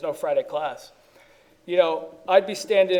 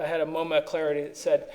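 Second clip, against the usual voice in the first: ordinary delivery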